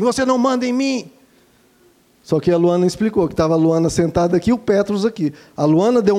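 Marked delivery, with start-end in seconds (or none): 0.0-1.1 mock yell. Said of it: "a filha grita assim".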